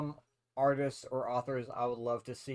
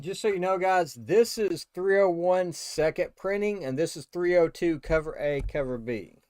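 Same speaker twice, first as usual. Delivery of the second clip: yelling across the room; 3 semitones higher, 7 dB louder than usual. Real, not acted. Announcing to viewers across room.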